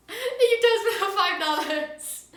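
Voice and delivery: high, loud, gushing thanks; excited thanks. Real, not acted. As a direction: squeal your gratitude.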